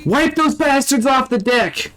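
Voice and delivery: high-pitched gung-ho marine imitation; Nolan North soldier. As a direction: bark it like a battle-hyped soldier. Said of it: "is Nolan North".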